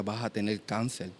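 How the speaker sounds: hushed divine pronouncement; Holy Spirit's voice. Retold or imitated. The Spirit telling him of his illness, quiet voice. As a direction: lightly voice a grave message, very softly. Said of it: "escuché la voz que me decía".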